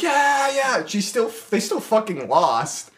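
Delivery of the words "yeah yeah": cheering. Retold or imitated. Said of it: "They're like".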